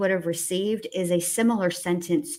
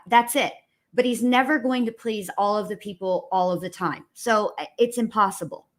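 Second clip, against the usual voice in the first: ordinary delivery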